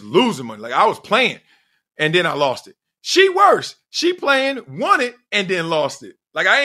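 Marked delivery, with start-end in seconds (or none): 3.0-3.8 loud high "She worse"; loud high emphatic "She worse". Real, not acted. Comparing her loss.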